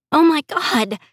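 high-pitched horrified exclamation; shocked. Acted. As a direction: react with stunned dismay, voice jumping up. Heroine hears terrible news.

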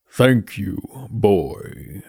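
slow, grave skeleton voice; Death's voice. Acted. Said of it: "said the skull".

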